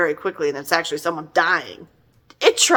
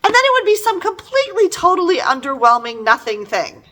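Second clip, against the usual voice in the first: exasperated; 10 semitones higher, 3 dB louder than usual. Real, not acted. Mocking underwhelming cliffhangers.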